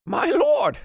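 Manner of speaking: very high, loud cry to his master; high excited goblin servant, breaking off; overjoyed goblin butler. Acted. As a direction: beckon your lord, overcome. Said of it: "And he goes".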